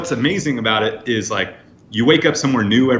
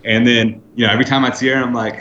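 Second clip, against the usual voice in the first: usual speaking voice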